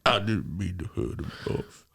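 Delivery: put-on apologetic movie voice; mock pleading in a film character's voice; film character impression. Host parodies the Green Mile to co-host, film character impression.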